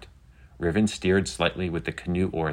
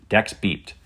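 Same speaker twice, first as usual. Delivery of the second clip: ordinary delivery, nothing marked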